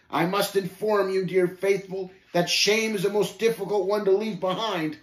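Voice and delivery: threatening growl. A narrator's reading. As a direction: lean in and threaten. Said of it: "growled threateningly".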